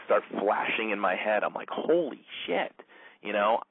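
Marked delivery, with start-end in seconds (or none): 1.7-2.7 high, drawn-out mock amazement; retold amazement. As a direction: act out a slow, high-pitched realization. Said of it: "all of a sudden, like, this alarm".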